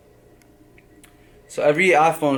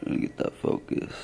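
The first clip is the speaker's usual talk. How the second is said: whispered aside; 11 dB quieter than usual. Real, not acted. Whispered aside while adjusting camera.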